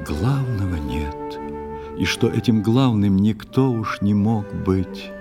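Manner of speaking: ordinary talk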